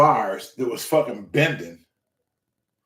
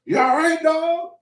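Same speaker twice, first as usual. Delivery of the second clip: high-pitched, alarmed questioning; startled disbelief; 13 semitones higher than usual. Retold or imitated. Newcomer checking on his grunting trainer, startled. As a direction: jump up high in mock alarm.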